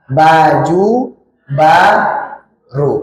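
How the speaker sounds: Baju baru very slowly, lips pressed on each b; exaggerated slow lip sounds; slow articulation demo. Shown as a demonstration. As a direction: spell out Baju baru for a learner.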